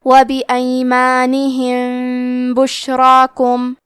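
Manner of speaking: وبایمانہم بشراکم with a hummed, hidden م; nasal ghunna. Shown as a demonstration. Teacher shows ikhfa shafawi, slow humming nasal. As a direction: hold a nasal hum on the م before بشراکم. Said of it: "یہ حکم کو غنہ کے ساتھ ادا کریں گے".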